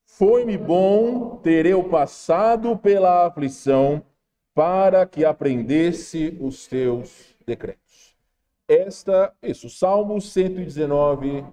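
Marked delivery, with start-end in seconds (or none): 0.1-8.2 group recitation. Acted. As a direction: chant it together slowly. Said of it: "vamos falar tudo juntos".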